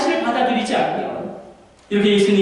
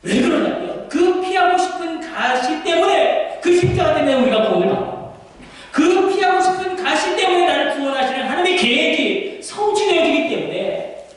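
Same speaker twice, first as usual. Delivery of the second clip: preaching at full force; 7 semitones higher than usual. Acted. Preacher explaining salvation through the cross, impassioned.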